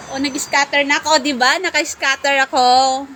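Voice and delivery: high, loud, rapid excitement; excited celebration. Real, not acted. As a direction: shout the lucky news out fast. Celebrates getting a scatter.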